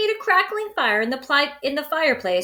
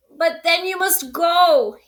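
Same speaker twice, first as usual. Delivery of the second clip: sniffling; 7 semitones higher, 4 dB louder than usual. Acted. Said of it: "he sniffled".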